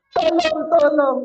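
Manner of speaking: high-pitched calling out; play-acted panicked plea; mock cry for help. Storyteller corrects the soldiers' cry for help, high mock shout. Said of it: "Mereka sambil teriak".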